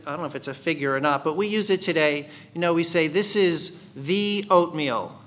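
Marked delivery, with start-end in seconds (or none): 3.1-5.2 "the" said as a long THEE, drawn out; stress on THE. Shown as a demonstration. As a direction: lean slowly on "the", as the one and only. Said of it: "puts emphasis on that".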